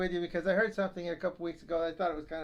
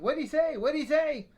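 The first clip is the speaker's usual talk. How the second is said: put-on high, louder old wife straining to hear; hard-of-hearing wife; 9 semitones higher, 8 dB louder than usual. Deaf wife asking her husband, high and loud. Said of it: "she's always saying".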